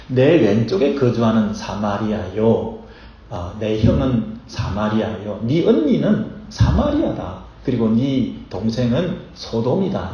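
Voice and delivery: slow, weighty declaration with each name stressed; slow solemn quote. Retold or imitated. Preacher quoting the prophet's verdict, slow and weighty. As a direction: read out the verdict slowly, heavy on 언니는 and 소돔이다. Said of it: "이렇게 말하는 거예요".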